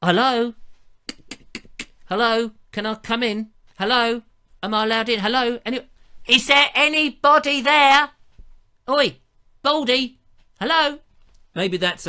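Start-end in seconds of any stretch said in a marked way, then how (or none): 0.0-8.1 raised, calling voices asking to be let in; hammed-up hello at the door, last call high and loud; impatient visitors calling. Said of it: "They're going". 8.9-11.0 raised cheeky hail, higher and louder; playful heckle; mock calling out. Calling out to him.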